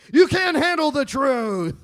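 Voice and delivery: hammed-up, high, drawn-out yell; movie-line outburst. Husband blurting a film quote to wife, shouting theatrically. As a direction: bellow it like a courtroom movie climax. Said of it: "any stupid movie line I could remember".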